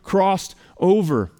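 "crossed over" drawn out slowly and stressed; emphasized phrase. Shown as a demonstration. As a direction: lean on "crossed over", slow. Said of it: "asterisk that word".

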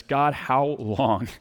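very slow, drawn-out lament; mock-weary groaning prayer; weary drawn-out plea. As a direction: stretch each word like a tired complaint.

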